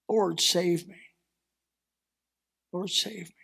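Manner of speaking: soft, fading cry for help; a hushed, broken plea; desperate plea. Acted. Peter sinking in the sea, pleading to Jesus in a soft, broken voice.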